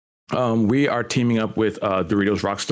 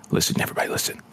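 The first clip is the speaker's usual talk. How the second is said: whispering; 3 dB quieter, much faster than usual. Real, not acted. Hushing to hear can open.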